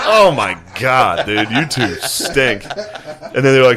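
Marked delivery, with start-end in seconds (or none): 0.0-2.6 slow, drawn-out teasing disgust; mock disgust. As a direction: drag out the words like you're grossed out. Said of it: "I was like".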